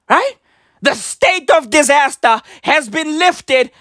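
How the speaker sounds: ordinary talk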